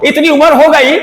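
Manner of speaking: loud, exasperated teacher's tirade; angry scolding. Acted. Teacher telling off students who laugh at him, angry scolding.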